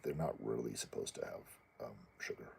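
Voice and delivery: soft, dropping whisper; hushed. Retold or imitated. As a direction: let the quote fade into a whisper. Quoting the biologist.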